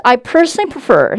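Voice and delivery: loud, projected model sentence; slow, strongly projected teacher demo. Shown as a demonstration. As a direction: push the voice out past a mask. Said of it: "마스크 밖으로 음성 뽑아볼게요".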